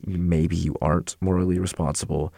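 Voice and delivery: ordinary delivery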